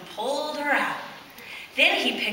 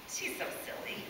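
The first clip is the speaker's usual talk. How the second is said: hushed whisper; 17 dB quieter than usual. Real, not acted. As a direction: breathe it gently. Whispering to child.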